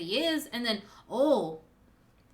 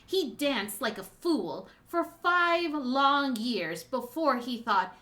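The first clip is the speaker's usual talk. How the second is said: impassioned speech, building louder toward the end; passionate; 5 dB louder than usual. Acted. Daughter recounting father's suffering to his betrayer, passionate. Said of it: "You were very passionate there".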